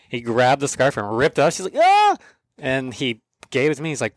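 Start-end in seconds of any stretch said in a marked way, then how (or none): 1.8-2.2 very high, louder shriek; startled shriek. Retold or imitated. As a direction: shriek like your scarf was ripped away. Woman's scarf ripped off.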